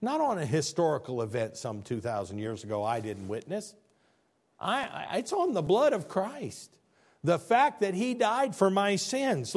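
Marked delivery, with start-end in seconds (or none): 4.6-9.6 high, climbing pulpit voice; preacher swelling on his own faith, higher; rising preaching. Acted. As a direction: build it up toward the blood of Christ. Preacher building again.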